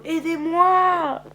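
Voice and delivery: high, loud, drawn-out cry for help; screaming for help. A narrator's reading. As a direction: scream desperately to be rescued. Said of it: "Sean hurle".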